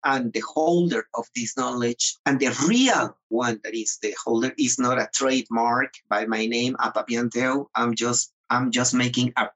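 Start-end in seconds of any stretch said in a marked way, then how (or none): none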